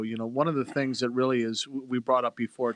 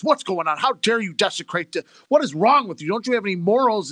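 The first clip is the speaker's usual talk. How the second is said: raised, louder mock indignation of a politician; mock outrage; 6 semitones higher, 4 dB louder than usual. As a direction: play the outraged politician, voice pushed up. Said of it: "the politician comes and goes".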